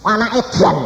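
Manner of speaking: mock paternal fury; retold angry father. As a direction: imitate an angry dad's outburst. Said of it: "Papa marah".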